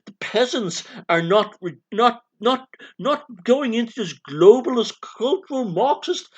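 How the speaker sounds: high, hammed-up aristocrat at a party meeting; mock posh socialist. Posh comrade complaining to Monty about the peasants, mock aristocrat voice. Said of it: "we talk like this".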